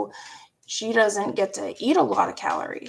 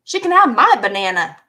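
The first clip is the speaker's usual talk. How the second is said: louder, insisting she can have it; firm retold retort; 9 dB louder than usual. Retold to viewers, standing up to brother with a firm loud retort. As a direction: push back hard at her brother. Quarrel with brother over banana.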